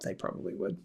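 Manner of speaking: hushed knowing aside to the viewers; whispered aside. Real, not acted. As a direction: drop to a conspiratorial whisper. Aside after a rhetorical question.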